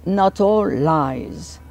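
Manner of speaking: "Not all lies" very slowly, stretching "all", falling at the end; English intonation. Shown as a demonstration. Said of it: "pour que la voix suive les intonations anglaises".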